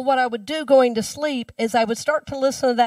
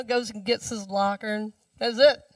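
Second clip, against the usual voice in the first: ordinary delivery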